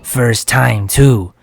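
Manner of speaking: lightly put-on friendly voice; easy character voice. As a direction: cheer them on as a pleased character. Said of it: "That's an easy voice, too".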